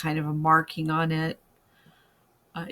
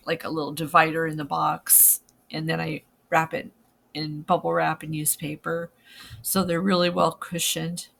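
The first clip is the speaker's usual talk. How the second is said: ordinary talk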